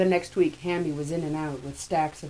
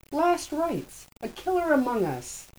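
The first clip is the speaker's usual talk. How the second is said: dramatic; 12 semitones higher than usual. A narrator's reading. Hammy pitching his book.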